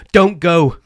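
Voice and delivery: sudden loud warning cry; urgent shout. Acted. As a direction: blurt it out in alarm. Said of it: "said Colin".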